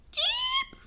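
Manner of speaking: very high, piercing cheep; booming cheep. Acted. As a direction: cheep out sharply like a chick. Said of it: "it boomed".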